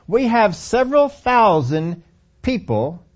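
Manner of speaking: ordinary talk, nothing marked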